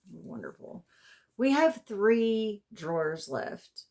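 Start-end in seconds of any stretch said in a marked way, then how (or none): 1.4-3.8 slow, deliberate. Real, not acted. Stressing drawers left, slowly.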